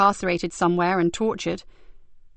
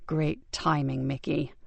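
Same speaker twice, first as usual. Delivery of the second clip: quiet; 4 semitones lower, 6 dB quieter, slower than usual. Acted. Boy has fallen asleep.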